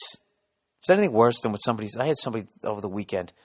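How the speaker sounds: ordinary talk, nothing marked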